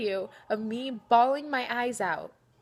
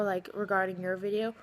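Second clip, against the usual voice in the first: usual speaking voice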